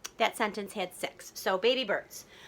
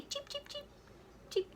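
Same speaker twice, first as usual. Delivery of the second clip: tiny, high, soft chirps; baby bird cheeps; 14 dB quieter than usual. Acted. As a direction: peep softly way up high like a chick. Imitating baby birds' cheeping.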